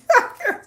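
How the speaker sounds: high cackle; very high real laugh. Real, not acted. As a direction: crack up.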